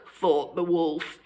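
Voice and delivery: ordinary delivery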